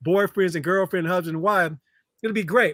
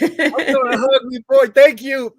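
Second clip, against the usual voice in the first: laughing while speaking; 5 semitones higher, 8 dB louder than usual. Real, not acted. Hugging inner child, laughing.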